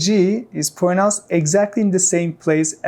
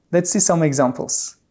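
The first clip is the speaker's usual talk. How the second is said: ordinary talk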